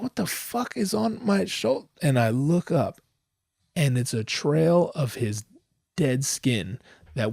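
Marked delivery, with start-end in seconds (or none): 0.0-1.9 shocked high yelp. Retold or imitated. Noticing skin dust on his shoulder, high startled and disgusted. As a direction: jump up in pitch in disgust. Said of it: "I'm like".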